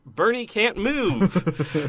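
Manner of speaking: game text voice. Retold or imitated. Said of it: "but it says".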